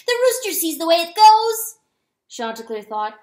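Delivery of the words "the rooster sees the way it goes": high, loud holler; calling out. A narrator's reading. Weasel calls to rooster on the coop, loud holler. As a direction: shout it up to the rooftop. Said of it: "he called as he neared".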